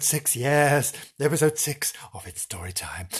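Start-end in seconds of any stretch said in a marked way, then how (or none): 1.2-3.1 hushed whisper. Acted. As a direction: whisper it secretly. Whispered repeat of title.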